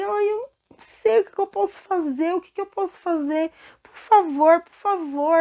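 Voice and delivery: high, desperate cries of a debtor; panicked pleading servant. Acted. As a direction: beg the king for mercy, voice climbing. Debtor begs again. (servo desesperado implorando ao rei, com a voz aguda)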